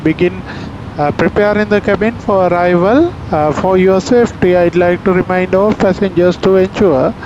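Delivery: calm airline PA voice; formal in-flight announcement; captain announcement. Real, not acted. Pilot's cabin PA announcement.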